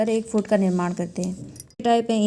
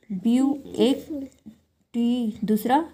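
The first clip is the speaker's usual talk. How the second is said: slow, drawn-out "view" and "टी", each numbered; counting off syllables; 2 semitones higher, much slower than usual. Shown as a demonstration. Teacher numbering the syllables of beautiful, slow and separated. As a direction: count "view" and "टी" one by one, with pauses. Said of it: "तीन syllable हो गए".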